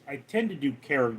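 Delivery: ordinary talk, nothing marked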